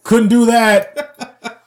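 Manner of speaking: loud, high, drawn-out mock shout. Viewer calling out an outdated joke, laughing shout. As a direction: shout it slowly with laughter creeping in. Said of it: "I'm just constantly shouting out".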